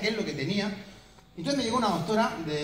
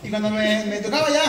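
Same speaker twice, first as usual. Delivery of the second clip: ordinary talk, nothing marked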